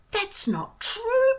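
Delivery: protesting. A narrator's reading. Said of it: "protested Hiccup".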